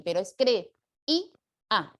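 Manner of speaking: cre, i, a said as three separated syllables; cre-i-a, each syllable apart; syllable split. Shown as a demonstration. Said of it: "En tres sílabas".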